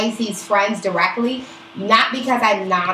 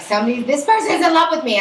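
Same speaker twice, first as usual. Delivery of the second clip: higher, excited mock-gushing; imitated lovestruck friend; 7 semitones higher, 4 dB louder, faster than usual. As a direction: gush like a friend who thinks she is adored. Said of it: "you're like".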